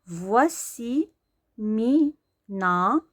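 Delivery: فواسي and مينا stretched out slowly, each rising; slow reading. Shown as a demonstration. Teacher reading فواسي مينا slowly to pupils. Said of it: "كنقراوها وا".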